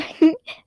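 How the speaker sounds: short light giggle; a quick bashful giggle. A narrator's reading. Patton giggling after saying I love you too. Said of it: "I giggled".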